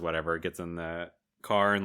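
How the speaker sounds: ordinary talk, nothing marked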